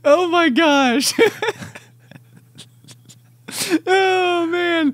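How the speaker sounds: high, laughing praise through giggles; breathless laughing delight. Real, not acted. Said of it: "laughing reaction to joke".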